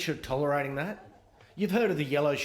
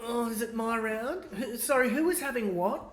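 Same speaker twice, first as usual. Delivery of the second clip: higher, flustered out-of-touch politician; clueless politician; 8 semitones higher than usual. Retold or imitated. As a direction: bluff through the round like a lost toff. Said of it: "politicians taking that test".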